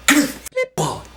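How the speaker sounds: loud, high yelling mugger; robber. Retold or imitated. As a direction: bark it like a panicked mugger. Robber cuts him off.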